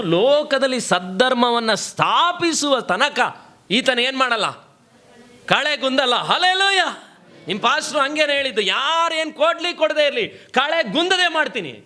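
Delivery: high-pitched, impassioned preacher; preacher in full flow; forceful preaching. Real, not acted.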